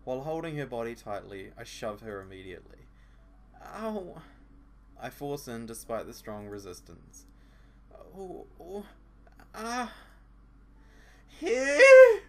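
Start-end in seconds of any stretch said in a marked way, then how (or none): 3.6-9.9 put-on girl's pained cries. 11.3-12.2 shrieked far higher and much louder; absurd squeal.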